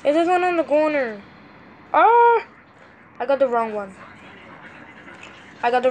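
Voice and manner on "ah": short, high, loud "ah"; frustrated cry. Real, not acted. Tapping the wrong one on the tablet, frustrated cry.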